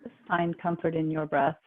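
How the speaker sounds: calm, even, unhurried relaxation guide; guided meditation. Real, not acted. Yoga teacher guiding the class into relaxation, calm guided meditation.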